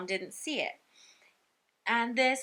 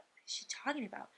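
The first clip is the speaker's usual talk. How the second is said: quick, whispered aside; puzzled whisper; 3 semitones higher, 12 dB quieter, much faster than usual. Retold or imitated. Puzzled mom to herself stopping the cart, whispering.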